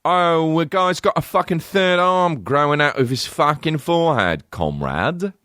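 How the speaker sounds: high comic British caricature; mock British accent. British actor as a Russian comrade describing mutations, mock British accent. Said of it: "literally British".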